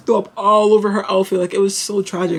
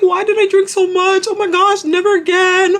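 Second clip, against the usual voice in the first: high-pitched mock wail, growing louder; self-pitying; 10 semitones higher, 3 dB louder than usual. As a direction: imitate a drunk friend's over-the-top regret. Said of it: "feeling bad for herself".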